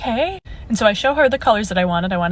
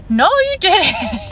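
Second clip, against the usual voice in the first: high, slow, drawn-out no you didn't; firm denial; 9 semitones higher, 4 dB louder, slower than usual. Retold or imitated. To the nail tech skipping a hand, slow insistent high denial. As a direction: spell it out to her slowly.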